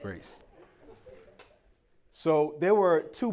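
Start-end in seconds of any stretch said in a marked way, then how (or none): 0.0-0.4 drops to a soft near-whisper; softly correcting himself in church; hushed self-correction. Real, not acted. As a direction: pull it back quietly, church voice. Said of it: "This is the Lord's house, though".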